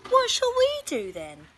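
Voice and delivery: put-on high, loud girl's whine; Laura, bored and high; whiny child. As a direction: complain like a bored kid. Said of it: "asked Laura".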